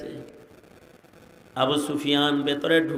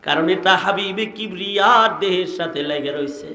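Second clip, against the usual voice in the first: ordinary talk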